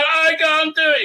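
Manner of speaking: high, hammed-up panicky wail; mock freak-out. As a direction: imitate someone losing their nerve. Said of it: "Renee's freaking out".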